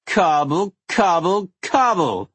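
high, loud tipsy word play; drunk singsong. Acted. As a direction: chant it drunk and giddy.

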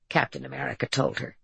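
plain reading voice

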